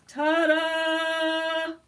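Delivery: high, loud sung reveal; singsong ta-da. Acted. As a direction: sing it out like a big reveal. Unveiling the snow bear.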